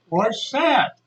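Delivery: lightly acted curious teacher; eager teacher's question. Teacher retold asking the secret.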